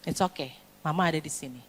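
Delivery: soft, low mother's reassurance; calm the child down, low and quiet; soothing. Acted. Mother telling bullied son she is here, soothing. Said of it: "Gitu, menenangkan".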